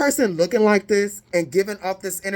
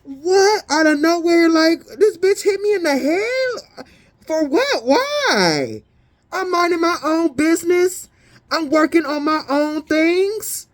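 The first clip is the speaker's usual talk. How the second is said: outraged disbelief; 7 semitones higher than usual. Pretend. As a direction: act baffled and wronged, voice climbing. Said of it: "and you like".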